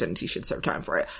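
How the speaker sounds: breathy whispered mutter; whispered aside. Real, not acted. Muttered aside after a point.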